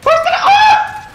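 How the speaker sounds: high, slow shout cracking into laughter; panicked laughing shout. Real, not acted. Lost in the game, shouting to her teammate, panicked laughing shout. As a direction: scream the question through a laugh.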